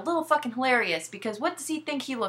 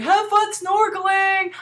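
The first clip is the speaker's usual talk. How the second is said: high, slow sing-song taunt; mocking taunt; 9 semitones higher, 6 dB louder, slower than usual. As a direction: sneer it as you toss the suitcase. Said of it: "he's like".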